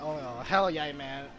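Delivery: ordinary talk, nothing marked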